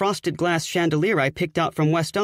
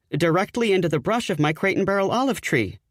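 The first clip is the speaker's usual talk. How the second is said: plain reading voice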